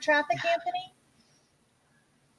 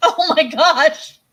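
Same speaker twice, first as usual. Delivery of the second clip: slow, then higher and louder spooked voice; playfully spooked; mock horror; 4 semitones higher, 5 dB louder than usual. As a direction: act freaked out. Mock horror at clown face.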